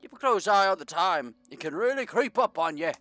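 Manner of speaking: a light British mayor warning of fog; lightly British, ominous warning; British accent. Acted. Mayor warns fisherman about the fog, light British accent. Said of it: "I don't know why I'm Br-, Br-, British".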